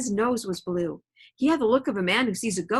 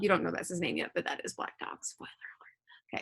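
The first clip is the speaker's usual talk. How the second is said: whispered aside; 3 semitones lower, 7 dB quieter than usual. Real, not acted. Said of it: "whispered aside to class".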